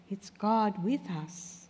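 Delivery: usual speaking voice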